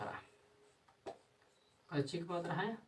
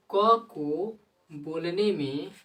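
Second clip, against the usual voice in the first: drawn-out demo; 3 semitones higher, 9 dB louder, much slower than usual. Shown as a demonstration. Teacher showing students how क is pronounced, slow and loud. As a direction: stretch it slow and louder. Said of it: "showing how क sounds".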